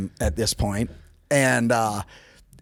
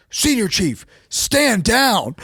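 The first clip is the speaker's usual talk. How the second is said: higher and louder retold command; commanding order; 9 semitones higher, 3 dB louder, much slower than usual. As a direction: bark a sharp order to stand down. Someone orders the chief off.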